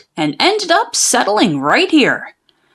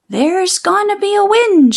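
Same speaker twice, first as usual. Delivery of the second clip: a hint of a spooky old witch, pitched up; 6 semitones higher than usual. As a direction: cast it like a prophecy, a touch crone-like. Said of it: "she said".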